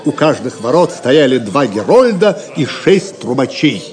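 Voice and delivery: ordinary delivery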